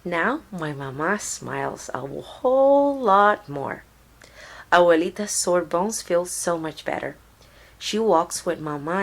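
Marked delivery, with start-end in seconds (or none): none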